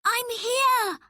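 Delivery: high, loud answering call; trapped boy answering his rescuers, loud; calling back. A narrator's reading. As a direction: shout back so they find you.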